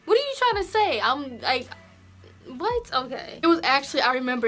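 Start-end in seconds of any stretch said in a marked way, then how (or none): 0.0-1.0 indignant disbelief. Retold or imitated. Retelling her reaction to school. 2.6-2.9 shocked what. Retold or imitated. Retelling her own reaction.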